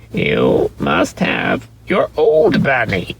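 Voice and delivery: slow, lightly grumbling nanny; a hint of a tired nurse grumbling; mild grumble, drawn out; Nana grumbling. A narrator's reading. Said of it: "she said".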